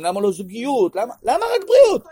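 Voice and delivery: high, loud exasperated question; exasperated outburst. Real, not acted. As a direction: burst out in protest. Rising rhetorical outburst. (פרץ מחאה גבוה וחזק)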